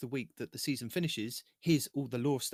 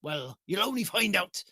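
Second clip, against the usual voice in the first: hearty pirate captain; 3 semitones higher, 3 dB louder than usual. Acted. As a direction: growl it like a sea captain. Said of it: "show them your pirate voice".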